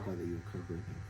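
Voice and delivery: fervent tearful prayer. Acted. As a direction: pray over the child, voice breaking.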